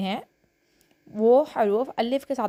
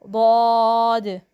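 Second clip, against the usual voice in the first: each letter called out slowly and louder, like a class chant; letter recitation; 4 dB louder, much slower than usual. Shown as a demonstration. Teacher reads the letter board to pupils, slow loud recitation. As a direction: chant the alphabet board for children to repeat.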